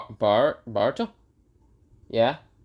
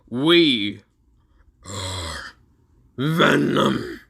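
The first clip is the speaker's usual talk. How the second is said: monster declaration; 3 dB louder, much slower than usual. Acted. Boruto introducing himself as Venom, monster voice. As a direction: announce the name like a beast.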